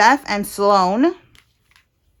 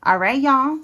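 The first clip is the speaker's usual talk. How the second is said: ordinary delivery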